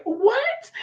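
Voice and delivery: high, surprised what?; startled what. Retold or imitated. Wife caught off guard by husband's remark, surprised. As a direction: snap back a puzzled what.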